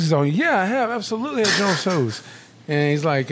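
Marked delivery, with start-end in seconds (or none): 0.2-2.2 high-pitched, emphatic yes; acted emphatic confirmation. Boss answers the question, acted emphatic confirmation. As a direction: imitate someone absolutely sure of it.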